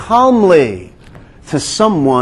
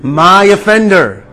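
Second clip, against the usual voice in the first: prayer recited aloud. Shown as a demonstration. Pastor leads the congregation in a vow, slow and emphatic. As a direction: lead the room in a spoken vow, word by word. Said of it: "Say it with me today".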